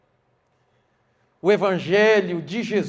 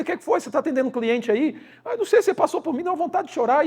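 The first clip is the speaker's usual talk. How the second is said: high dialogue; 8 semitones higher than usual. Retold or imitated. Pastor and crying subgerente.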